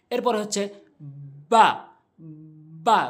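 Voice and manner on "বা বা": বা from the dry part of both lips; letter ba demo. Shown as a demonstration. Teacher showing learners where it forms, careful বা. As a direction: press the lips, then release a clear long বা. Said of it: "দুই ঠোঁটের শুকনো অংশ".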